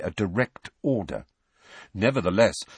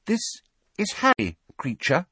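put-on high, hesitant girl's voice; tentative, with small pauses; 12 semitones higher than usual. A narrator's reading. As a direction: introduce someone gingerly, pitched up. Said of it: "said Hermione tentatively".

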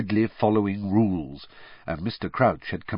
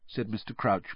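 plain reading voice

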